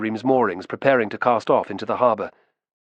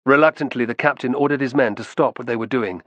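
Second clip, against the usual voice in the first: usual speaking voice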